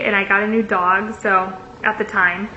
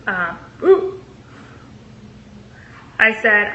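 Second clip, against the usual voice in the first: ordinary talk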